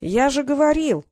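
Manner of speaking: significant, self-vindicated emphasis; meaningful I-told-you-so. A narrator's reading. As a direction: draw it out knowingly. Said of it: "многозначительно произнёс мальчик".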